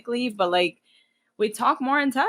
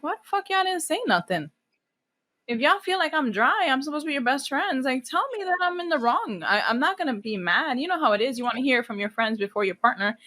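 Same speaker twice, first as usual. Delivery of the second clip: high-pitched, exasperated protest; indignant retelling; 5 semitones higher than usual. Retold or imitated. Asking her friends why they said nothing, indignant. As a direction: plead indignantly with your friends in a raised pitch.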